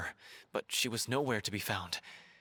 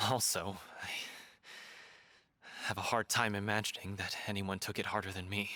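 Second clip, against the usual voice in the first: ordinary delivery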